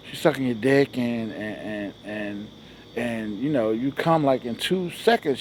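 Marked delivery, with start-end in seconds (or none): none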